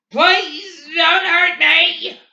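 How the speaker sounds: high, loud, slow begging shriek; terrified scream. A narrator's reading. Redhead begging the soldiers, a terrified shriek. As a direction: scream it in pure terror.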